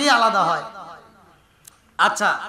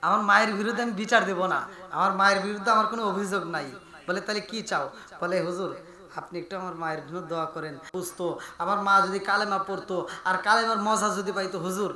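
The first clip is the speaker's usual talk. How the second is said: lower, softer voice trailing quieter; humble plea; 6 semitones lower, 8 dB quieter than usual. Acted. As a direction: plead gently on the mother's behalf. (নিচু গলায় বিনীত অনুরোধ)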